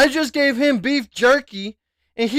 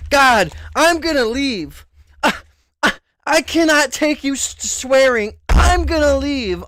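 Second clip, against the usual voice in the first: mocking whiny viewer; 5 semitones higher than usual. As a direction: ham up a prissy viewer storming off. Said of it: "people think they're so important enough to say".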